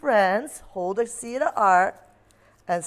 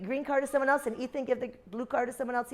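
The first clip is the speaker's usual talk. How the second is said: normal talking voice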